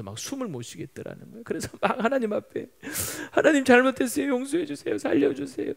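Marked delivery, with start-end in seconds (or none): 3.3-5.7 high, urgent, acted plea for mercy; tearful repentance. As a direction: beg desperately for your life, pitched up high. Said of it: "막 눈물 쏟으면서 그렇게 울면서". (울먹이듯 다급하게 빌며)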